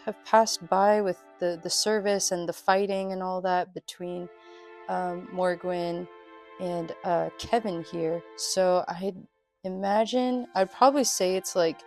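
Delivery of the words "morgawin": ordinary talk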